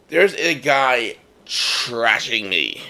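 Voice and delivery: drawn out, dramatic pause; slow dramatic. Real, not acted. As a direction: spell it out slowly.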